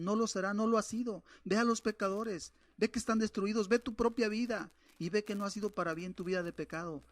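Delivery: mounting preacher's reproach; preaching with rising force. Acted. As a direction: drive the warning home to the sinner. Preacher rebukes sinners.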